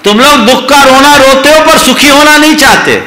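high, loud rebuke; loud scolding repeat. Shown as a demonstration. Preacher scolding listeners for wallowing in sorrow, loud and high. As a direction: hammer the point back at them. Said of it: "आप दुख का रोना रो".

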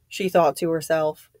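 ordinary delivery

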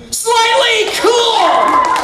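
"Slightly" shouted high and loud in triumph; showman's victory yell on "Slightly"; triumphant shout. Acted. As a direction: belt out "Slightly" like a winning reveal. Triumphant reveal after spectator confirms.

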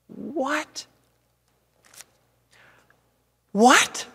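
high, incredulous "What?"; baffled double take; stunned disbelief. Retold or imitated. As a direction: react as if it makes no sense. Stunned by the wife's answer.

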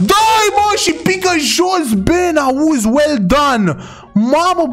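high, fast, urging the chase on; excited chase. Real, not acted. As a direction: cheer the chase like it's live. Chasing an armed suspect in game. (urlând entuziasmat după suspect)

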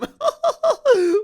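high burst of laughter; big laugh at a silly question; loud laugh. Real, not acted.

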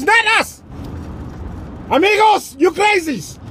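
put-on high, loud Mexican-accented protest; hammed-up outraged Mexican yelling; indignant denial. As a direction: shout the blame back. Acted Mexican arguing with American.

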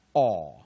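哦 as a loud, held "aw"; single vowel aw. Shown as a demonstration. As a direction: belt out 哦 as one open vowel, no glide. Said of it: "一个念". (哦 大声念成拉长的单一元音)

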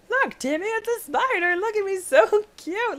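very high, cooing "so cute"; high cooing baby voice; cooing baby talk. Pretend. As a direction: coo like showing a child a pet. Cooing to Timmy about spider.

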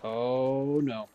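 ordinary talk

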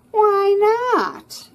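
mock him with a sneer; sarcastic mocking. Voicing sarcastic mocking mole rat.

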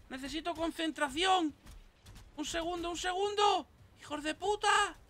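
high, strained cries of alarm; panicked pleading. Real, not acted. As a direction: protest frantically while under attack. Under attack in game.

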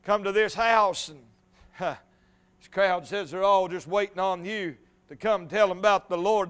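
ordinary delivery

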